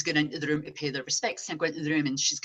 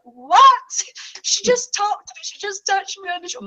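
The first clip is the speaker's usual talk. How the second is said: high, loud disbelief; astonished; 10 dB louder than usual. Retold or imitated. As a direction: cry out in shock. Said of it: "And they're like".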